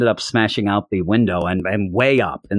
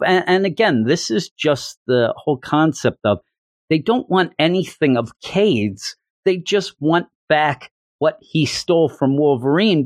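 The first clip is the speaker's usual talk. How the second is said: ordinary talk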